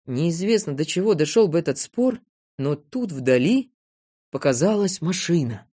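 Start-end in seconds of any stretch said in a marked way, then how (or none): none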